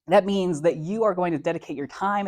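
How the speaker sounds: ordinary talk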